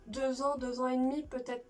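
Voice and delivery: usual speaking voice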